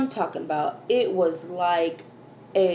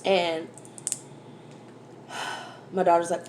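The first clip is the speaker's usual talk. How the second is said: ordinary delivery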